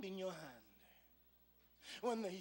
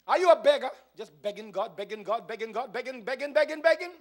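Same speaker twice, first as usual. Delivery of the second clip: building louder and higher with each begging, mock whine; mocking beggar; 5 semitones higher, 16 dB louder, faster than usual. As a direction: ramp up the mockery of endless begging.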